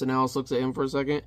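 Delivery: ordinary talk, nothing marked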